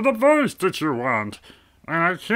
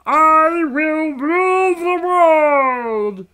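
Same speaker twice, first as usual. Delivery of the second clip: higher, louder, slow; Brain villain voice; 7 semitones higher, 6 dB louder, slower than usual. Acted. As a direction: proclaim world domination grandly. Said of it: "That's my favorite thing to say in that voice".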